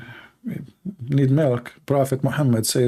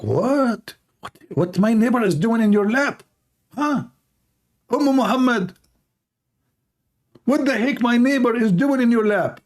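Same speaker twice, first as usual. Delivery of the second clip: high, indignant husband's voice; husband catches his wife with the neighbor, outraged and high; shocked husband; 9 semitones higher than usual. Acted. Husband confronts wife, acted.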